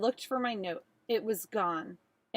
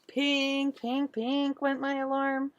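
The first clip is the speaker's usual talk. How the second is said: alarm chime; 4 semitones higher, slower than usual. A narrator's reading. Alarm sound imitated.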